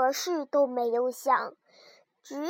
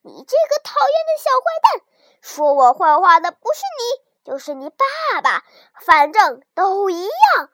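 louder, angry wolf bellowing; the wolf closing in and yelling; shouting wolf; 3 semitones higher, 7 dB louder than usual. A narrator's reading. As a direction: roar it at the lamb. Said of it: "大声嚷道".

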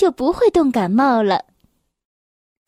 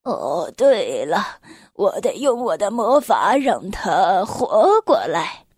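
put-on low, musing old man voice; thoughtful Santa; 5 semitones lower than usual. A narrator's reading. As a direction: think aloud as a deep Santa. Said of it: "圣诞老人心里想着".

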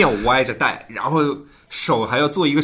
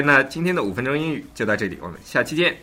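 usual speaking voice

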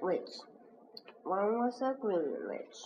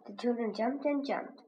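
ordinary delivery

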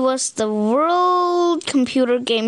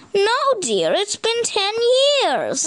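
weary parent; 8 semitones higher than usual. Acted. Parent answers princess.

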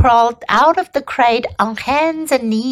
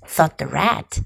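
usual speaking voice

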